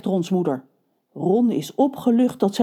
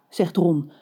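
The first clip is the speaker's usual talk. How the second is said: usual speaking voice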